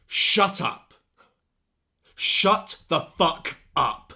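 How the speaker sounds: full-force imitation of a raging teacher; shouting. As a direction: shout it in the student's face, words stretched. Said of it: "shouting that at me in my face".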